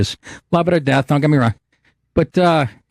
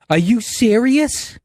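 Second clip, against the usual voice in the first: slow, higher, disbelieving sneer; mock waitress; 9 semitones higher, much slower than usual. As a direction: drag it out like a dismissive waitress. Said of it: "That's what she says".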